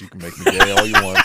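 loud laughing joke. Real, not acted. Joking loudly, laughter around.

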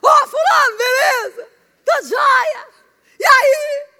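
high booming greeting; imitating the husband's loud greeting; loud airport voice. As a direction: belt it like calling across an airport. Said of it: "elas falam assim mesmo".

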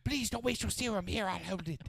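begging, high and whiny; high pleading voice. Pretend. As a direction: plead like a groveling servant. Voicing Blake as collector.

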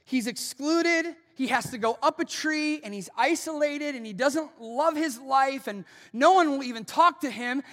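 loud mock lament; mock reader's outburst. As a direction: wail out the reader's pity for him. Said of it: "you're like".

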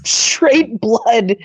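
usual speaking voice

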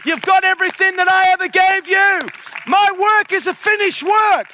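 high, loud sermon climax; impassioned preacher shouting to the finish; full-force preaching. Real, not acted. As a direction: drive it home in ringing repeated declarations.

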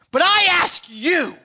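ordinary talk, nothing marked

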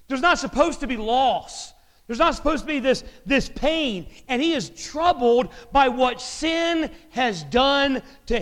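full-force preacher voice; fervent preaching. Acted. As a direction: drive the truth home from the pulpit.

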